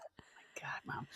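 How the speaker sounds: hushed whispered groan; embarrassed whisper. Retold or imitated. Daughter mortified by her mom's stories, whispered. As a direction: breathe it out, mortified.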